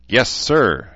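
sarcastic. Acted. Daughter mocks her father's order, sarcastic and loud. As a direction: toss it back with sarcasm. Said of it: "she said sarcastically".

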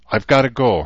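urgent, torn at having to go; desperate. A narrator's reading. Herzer must leave Rachel for the march, desperate. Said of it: "Herzer said desperately".

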